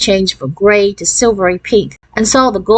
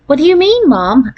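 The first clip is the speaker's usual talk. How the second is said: put-on high, eager child's question; 7 semitones higher than usual. A narrator's reading. Daughter asking Mom about Thanksgiving plans, eager. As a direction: lift the pitch high with eager curiosity. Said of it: "an eager look in her eyes".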